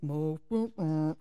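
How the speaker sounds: low, plodding dog voice; dopey low dog impression; doing Buster. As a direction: drop low and slow for the dog. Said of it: "I'm doing Buster now".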